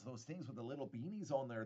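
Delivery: normal talking voice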